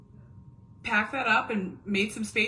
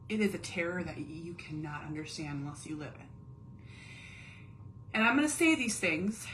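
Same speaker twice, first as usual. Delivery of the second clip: normal talking voice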